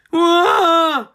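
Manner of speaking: high loud wail of falling; falling yelp. A narrator's reading. Chickens tumbling down the silo with a loud high yelp. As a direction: yelp as you drop. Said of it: "They all fell down".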